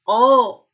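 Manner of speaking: teacher sounding out the hidden अ; drawn-out vowel demo. Shown as a demonstration. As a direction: stretch the अ so students hear it. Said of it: "sound क्या आ रही है".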